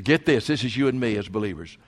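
usual speaking voice

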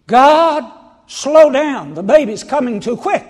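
high, loud, frantic plea to God; mock panicked prayer. Expectant mother praying the baby slows down, mock frantic. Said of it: "ever prayed that prayer?".

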